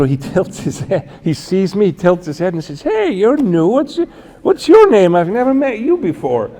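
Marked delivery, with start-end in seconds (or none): 2.8-6.5 lifted much higher, lightly imitating a kindly old man; a bright, curious hello to the newcomer; friendly Pope greeting. The Pope greets the new guard, lightly imitated, pitched much higher.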